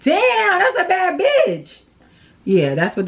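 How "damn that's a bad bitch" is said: high, admiring exclamation; impressed admirers. Pretend. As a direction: gush like a passer-by stunned by her look. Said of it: "they're gonna be like".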